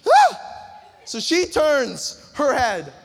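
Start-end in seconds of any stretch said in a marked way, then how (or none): 0.1-0.4 squeal. Acted. Girl spotting the guy.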